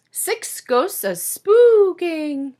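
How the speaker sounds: mock ghost call for the kids; spooky ghost voice. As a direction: draw it out like a playful ghost. Ghosts in spooky counting book.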